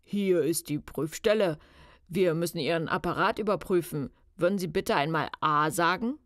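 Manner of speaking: put-on official male caller; loud, self-important inspector on the phone; disguised deep male voice; deep man's voice. A narrator's reading. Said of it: "sagte eine tiefe Männerstimme".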